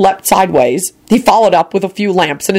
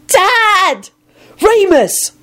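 very high, joyful cries; children's squeals of greeting; excited calling out; much slower than usual. Acted. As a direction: call each name in a high, delighted voice. Children greet returning father with cries.

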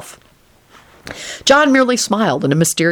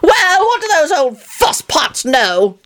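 put-on higher, slow, scoffing old man; huffing; 11 semitones higher, slower than usual. A narrator's reading. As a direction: brush it off with a huff. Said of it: "John huffed".

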